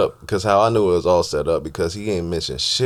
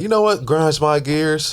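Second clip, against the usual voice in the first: mock rant; 8 semitones higher, slower than usual.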